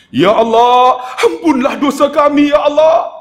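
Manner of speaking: high, loud cry to God; emotional plea. Retold or imitated. Preacher leading pilgrims in prayer on Jabal Rahmah, high loud emotional plea. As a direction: implore at the top of the voice, pitch soaring. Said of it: "Saya mainkan perasaan".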